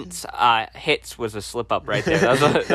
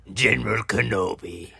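General Grievous impression; 5 semitones lower than usual. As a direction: give it your best Grievous. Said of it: "then you make me do the voice".

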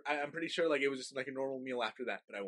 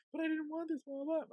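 put-on high whiny child, much higher pitch; whiny kid voice. Kid pleading with mom about rice, high whiny voice. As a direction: whine like a little kid. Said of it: "I'm just like".

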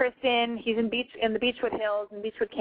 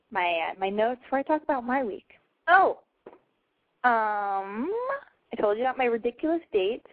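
ordinary talk

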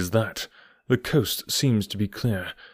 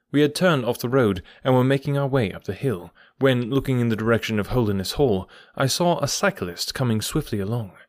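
plain reading voice

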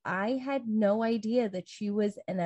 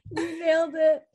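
high laughing praise; 9 semitones higher than usual. Real, not acted. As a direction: squeal it through laughter. Laughing hard at joke.

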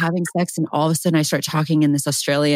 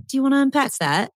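higher-pitched mock Australian accent; 6 semitones higher than usual. Host rehearsing the line for the bedroom, Australian accent. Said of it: "hear how you say it".

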